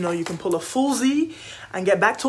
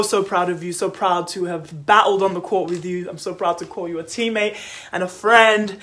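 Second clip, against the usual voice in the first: ordinary delivery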